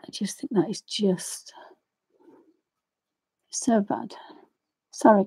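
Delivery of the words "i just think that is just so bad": hushed, breathy whisper, slowed by emotion. Real, not acted. Talking to viewers about cruelty, a real hushed whisper. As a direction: drop to a whisper at the thought. Upset, repeating her feelings.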